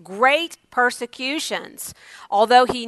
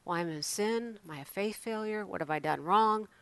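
much softer, self-doubting inner voice; dejected murmur; 15 dB quieter than usual. Retold or imitated. Pastor imitating believers doubting themselves, deflated and quiet. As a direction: say it to yourself, beaten down.

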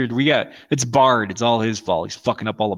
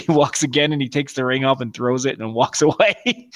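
ordinary talk, nothing marked

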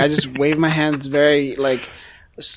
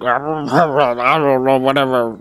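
slow, slurred dismissive mumble; lightly mock a couldn't-care-less reply; imitating Dolomite; slower than usual. Player predicts Dolomite's reaction, mock dismissive. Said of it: "is just gonna be like".

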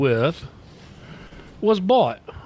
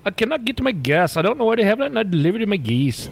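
higher, mock Indian accent; put-on Indian accent; 6 semitones higher than usual. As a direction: mimic the agent's Indian accent back at her. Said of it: "I put the accent on".

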